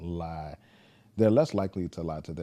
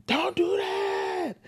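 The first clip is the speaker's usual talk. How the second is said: very high, slow, drawn-out shout; son's bark; 5 dB louder, much slower than usual. Retold or imitated. Father imitating his son's shouted order, very high drawn-out shout. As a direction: shriek it like a worked-up toddler. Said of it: "we bark at each other".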